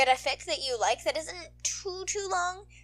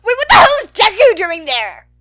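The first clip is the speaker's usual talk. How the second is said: loud shocked yell, higher; shout; 7 semitones higher, 16 dB louder than usual. Acted. A viewer shouting at the broadcast about Deku, outraged. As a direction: yell at the screen in disbelief.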